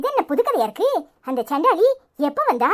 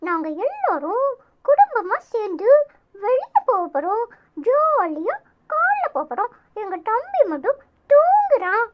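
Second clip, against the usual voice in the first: put-on higher childlike voice, cheerful; child voice; 5 semitones higher than usual. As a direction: play an excited small child talking to viewers. Playing the children addressing viewers.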